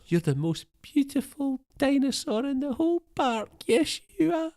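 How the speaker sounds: put-on high, cooing old man voice; high, cooing impression of a proud old man; doting Attenborough. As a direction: coo to the dinosaur like a proud grandad. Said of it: "the only actually genuine line of his".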